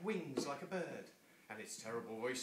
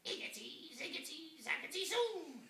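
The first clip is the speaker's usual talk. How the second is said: witch spell. Acted. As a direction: chant the spell higher and higher. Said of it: "she muttered a spell".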